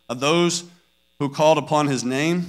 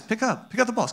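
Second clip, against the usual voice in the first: soft, higher-pitched coaxing; very nice; 5 semitones higher, 7 dB quieter than usual. Retold or imitated. Parent starting cleanup time with the kids, very nice. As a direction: ask sweetly and softly, playing patient parent. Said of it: "I start off very nice".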